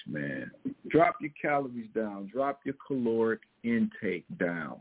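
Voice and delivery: usual speaking voice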